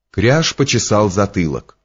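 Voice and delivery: usual speaking voice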